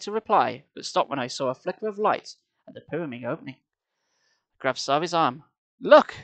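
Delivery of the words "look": sharp high cry; urgent exclamation. A narrator's reading. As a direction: call out, startled. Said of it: "I whispered".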